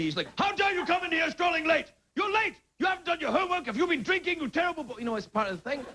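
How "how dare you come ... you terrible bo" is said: much higher, mock-outraged headmaster scolding; mock headmaster yell at a late boy. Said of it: "he's, like, yelling at me".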